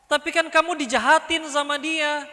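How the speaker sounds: high-pitched insistent objection; egging on. Acted. Friend reminds the victim they were wronged, insistent.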